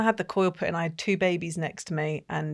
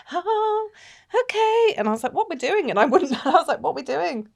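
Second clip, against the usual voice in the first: high nervous squeak; 7 semitones higher than usual. Retold or imitated.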